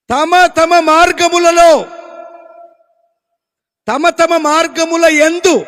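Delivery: loud slow stress on తమ తమ. Acted. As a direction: slowly hammer తమ తమ. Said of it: "reads verse, stressing repeated phrase".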